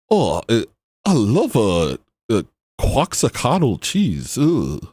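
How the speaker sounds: halting, swooping put-on Mexican accent; hammed-up Mexican-accented broken English; mock Mexican accent. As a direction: fumble through it in an imitated Mexican accent. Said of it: "speaking broken English to them".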